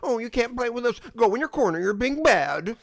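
high, loud playground telling-off; mock scolding.

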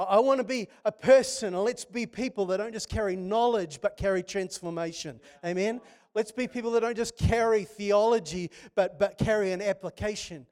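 high, loud sermon call; rally the church with conviction; fiery preaching. Acted. Preacher urging the congregation to carry transformation, fervent.

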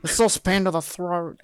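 put-on broad Australian vowels; Australian accent. Podcaster quoting an Australian character, mock Australian accent. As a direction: imitate an Australian movie line in accent. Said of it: "They sound so".

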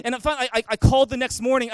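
usual speaking voice

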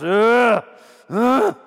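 two loud wordless grunts; demonstrated grumbling noise; frustrated grumbling grunt. Shown as a demonstration. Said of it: "you don't say anything".

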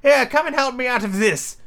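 high-pitched, impatient order; bossy master in a high voice; cross Rabbit. A narrator's reading. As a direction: demand help in a high, irritated character voice. Angry Rabbit orders his servant.

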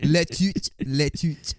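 cartoonish French-accented tooting; mock French accent. Teased for horn-tooting, answers with mock French accent. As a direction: toot a horn in comic French. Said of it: "I'll toot it in French for you".

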